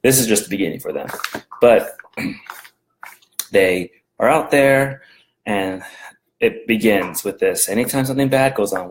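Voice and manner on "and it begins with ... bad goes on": normal talking voice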